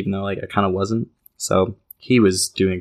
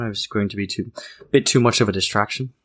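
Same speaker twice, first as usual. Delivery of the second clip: ordinary delivery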